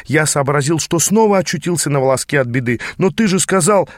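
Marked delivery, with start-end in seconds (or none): 3.0-3.9 higher, pleading protest. A narrator's reading. Apprentice protesting to his angry mentor, pleading. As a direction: object quickly, hurt. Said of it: "запротестовал я".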